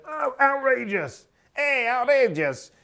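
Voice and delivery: high, slow hammed-up Italian accent; exaggerated Italian accent, drawn out. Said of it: "That was Italian accent".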